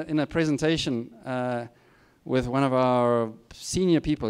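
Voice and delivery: normal talking voice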